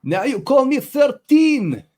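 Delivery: high, loud, drawn-out war cry; shouted battle cry. Acted. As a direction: bellow it slowly across a battlefield. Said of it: "I'd be now shouting".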